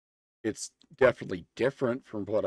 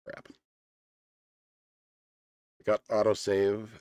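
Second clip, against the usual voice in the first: ordinary talk, nothing marked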